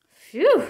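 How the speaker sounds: loud, high exhale of relief; relieved phew. A narrator's reading. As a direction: blow out the breath, finally safe. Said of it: "breathed Brother".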